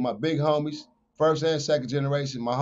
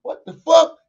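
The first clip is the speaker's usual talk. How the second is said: shocked outburst; 11 semitones higher, 10 dB louder, slower than usual. Retold or imitated. Sudden hit from behind.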